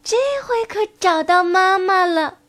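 high, glad little voice; be delighted, in a child's high pitch; happy tadpoles. A narrator's reading. Tadpoles thinking the turtle is mum, high and happy. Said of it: "高兴地说".

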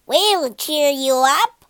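loud, slow, cheery group shout. Acted. Toys crowd round the sad puppet, cheery loud shout. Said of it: "shouted the toys".

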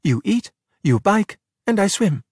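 deliberate, excited listing; eager assigning. A narrator's reading. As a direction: count off each role, upbeat. Giulia assigning race roles eagerly.

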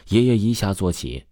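ordinary delivery

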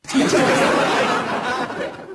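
high laughing burst; bright high laugh; high giggle. Real, not acted. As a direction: giggle at the punchline. Laughs at own joke.

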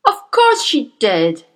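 slow, loud booming; boomed. Acted. Headmistress booms at the teacher, slow and loud. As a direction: boom it down at her. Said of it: "Miss Trunchbull boomed".

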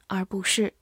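ordinary talk

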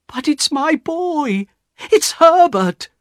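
high, drawn-out mother's cry; desperate, high-pitched insistence; impassioned plea. A narrator's reading. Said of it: "she answered".

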